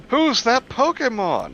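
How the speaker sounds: high, slow quiz-show call; Pokemon catchphrase. Pretend. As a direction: sing it out like the TV quiz.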